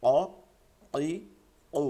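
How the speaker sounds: "অ", "ই", "ও" as short separate heavy qaf bursts; heavy, struck qaf. Shown as a demonstration. Teacher repeats the three vowels after correcting, heavy qaf. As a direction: punch out "অ", "ই", "ও" from the tongue root. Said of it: "ভারী দিতে হবে".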